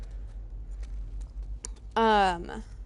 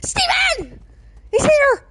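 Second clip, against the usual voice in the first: high, loud squeal of delight; excited shout; 8 dB louder, slower than usual. Real, not acted. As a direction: shriek a thrilled greeting. Spots a friend arriving in chat.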